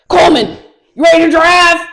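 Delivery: big, high imitation holler; hollering instructor. Driving instructor summons a high student, loud booming call. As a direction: call the student out at full volume.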